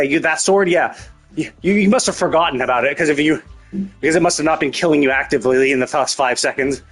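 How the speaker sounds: ordinary delivery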